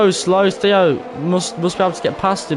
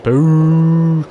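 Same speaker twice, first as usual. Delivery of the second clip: drawn-out, held jeering boo; long boo; 5 dB louder than usual. Real, not acted. Streamer jeering another safety car, long boo.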